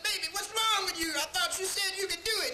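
worried voice, pushed high and thin; high mock voice. Attendant fussing over the fallen man, high mock voice. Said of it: "He said".

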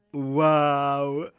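loud amazed wow. Real, not acted. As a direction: exclaim in awe. Loud exclamation.

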